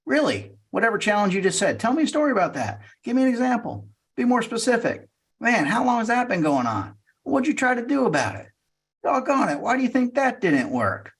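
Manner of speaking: high-pitched, eager probing questions; genuinely curious. Acted. Salesperson probes a prospect's problem, high and curious. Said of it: "When you're genuinely curious".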